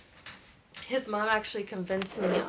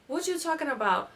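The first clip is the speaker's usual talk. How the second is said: a higher quick what-are-you-talking-about; quick innocent reply; 7 semitones higher, 3 dB louder than usual. Retold or imitated. Boyfriend dodging her question, quick and pitched up. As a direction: play dumb fast, pitched up. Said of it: "Like, quick".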